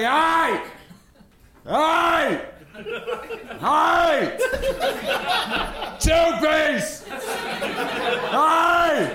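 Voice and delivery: high shouted calls; yelling at the counter. Shown as a demonstration. Showing friend how to yell at the chicken joint, high calls. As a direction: call out sharply to get attention. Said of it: "Just give him a quick".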